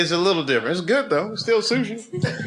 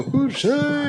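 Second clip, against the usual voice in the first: high mock Japanese chef greeting; 14 semitones higher than usual. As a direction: call it out like a sushi chef welcoming guests. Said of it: "they go".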